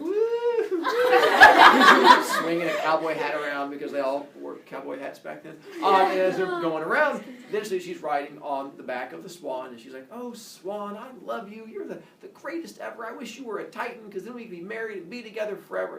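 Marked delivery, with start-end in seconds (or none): 0.0-2.4 high, loud whoop with a laugh; joyful woo. Acted. Riding the swan around the pond, squealing woo with a laugh. 10.1-16.0 softer, higher swoony sigh; lovestruck. Acted. Leto pouring out her love to the swan, lightly swooning.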